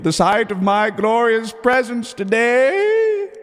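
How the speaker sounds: slow, high, rising proclamation; pompous emperor. Acted. Emperor grants peasants sight of his presence, pompous proclamation. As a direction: build to a long, high regal flourish.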